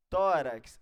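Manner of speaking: Tórax with a loud, punched TÓ; stress on first syllable. Shown as a demonstration. Teacher giving a matching example, loud stressed TÓ in Tórax. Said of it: "a mesma coisa".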